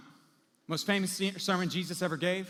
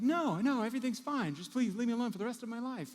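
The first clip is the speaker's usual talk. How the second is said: fake cheerful denial; 8 semitones higher than usual. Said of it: "And you're like".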